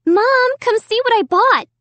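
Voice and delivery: high, loud call to mom. A narrator's reading. Girl home from shopping to mom, calling out. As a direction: call out brightly across the house, pitched high. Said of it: "she called".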